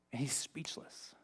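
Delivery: a hushed aside to the congregation; whispered aside. Real, not acted. Dramatic aside on Haman's shock.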